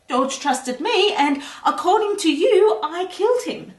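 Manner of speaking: high, wounded protest; indignant retort. Acted. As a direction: throw the accusation back, pitched high.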